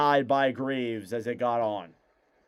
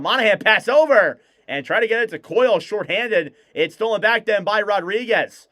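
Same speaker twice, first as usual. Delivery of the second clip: excited call; 6 semitones higher, 6 dB louder than usual. Acted. Scoring chance rush.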